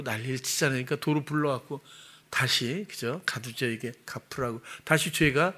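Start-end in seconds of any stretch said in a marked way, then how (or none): none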